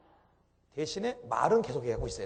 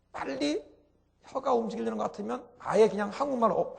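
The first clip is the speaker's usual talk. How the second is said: ordinary talk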